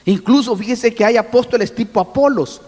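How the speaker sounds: normal talking voice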